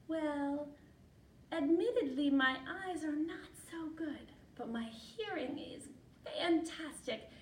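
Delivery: high, careful declaration of admiration; little mouse suitor. Acted. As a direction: court her as a small mouse, each name set apart.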